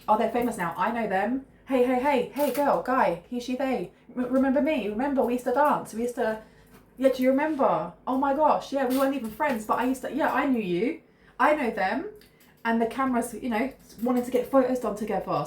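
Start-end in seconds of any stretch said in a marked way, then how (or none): 0.1-12.1 high, loud, excited clamour for attention; eager old acquaintance. Acted. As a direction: pester a now-famous acquaintance to remember you. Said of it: "This is somebody going".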